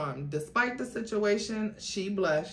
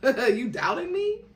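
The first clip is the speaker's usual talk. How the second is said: higher, laughing through it; laughing question; 10 semitones higher, 5 dB louder than usual. Acted. Sean mocking Jennifer's doubt, laughing. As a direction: tease her with a laugh. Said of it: "He laughed as he asked".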